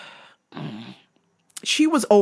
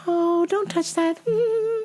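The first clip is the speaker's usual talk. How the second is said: high, slow, airy mock-mom voice; spacey mother imitation; 12 semitones higher than usual. Mother half-minding her kids in the store, airy and ineffectual.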